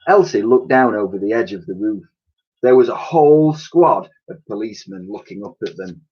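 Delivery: plain reading voice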